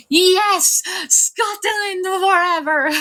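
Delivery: hammed-up patriotic shout, stretched out; playful rallying cry. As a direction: belt it out like a mock battle cry.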